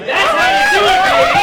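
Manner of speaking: very high, very loud victory scream; triumphant yell. Acted. Comic ends his set by screaming his triumph very high and loud. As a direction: shriek it like you conquered the city.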